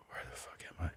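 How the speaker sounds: hushed, urgent whisper; panicked whisper. Retold or imitated. Sneaking through her kitchen, a frantic whisper. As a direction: whisper it so nobody in the house hears.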